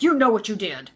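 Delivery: loud, accusing imitation of an angry dad; imitated stern scolding; angry husband yelling. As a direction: throw the accusation back hard and loud. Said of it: "he came after her just like this".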